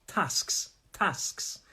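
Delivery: TASKS with a short "a" as in cat; quick short vowel in TASKS; northern short "a". Shown as a demonstration. Teacher drills the northern version, short-"a" TASKS. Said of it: "So it's an a".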